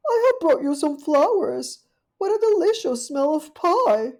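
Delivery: high, loud genteel caller; a polite little dog at the door; Duchess's voice. Acted. Duchess calling at Rivy's porch, high and genteel.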